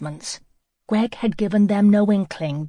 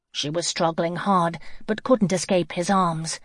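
usual speaking voice

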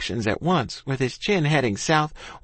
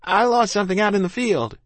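high, raised call back; pitched-up calling voice; shouted; 8 semitones higher, 3 dB louder than usual. A narrator's reading. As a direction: shout it back while getting away. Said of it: "I shouted".

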